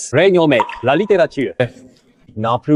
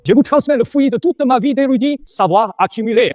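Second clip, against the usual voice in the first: high-pitched, grand declaration; solemn proclamation; 9 semitones higher than usual. Acted. Scholar hands down his life's learning, solemn proclamation. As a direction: proclaim it like a precious legacy. Père lègue solennellement son savoir.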